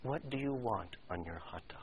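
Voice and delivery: calm whispered question; even whisper. Acted. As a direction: lean in and ask just him. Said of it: "She said evenly to the youngster".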